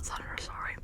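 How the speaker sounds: ordinary talk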